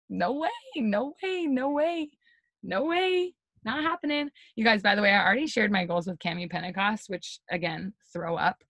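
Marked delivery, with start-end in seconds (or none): 0.1-4.3 high, slow, incredulous "no way"; a nagging inner doubter refusing to believe; doubtful inner voice. Retold or imitated.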